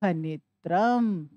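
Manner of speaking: खनित्रम drawn out slowly, each syllable spaced; slow reveal of the word. Shown as a demonstration. Teacher to students revealing the joined word, slowly. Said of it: "तो क्या बना पूरा शब्द?".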